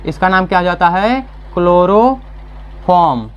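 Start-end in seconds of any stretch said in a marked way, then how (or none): 1.5-3.3 slow, stretched chemical name; drawn-out key term. Real, not acted. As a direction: dictate the name slowly to a class. Teacher answers own question with key term.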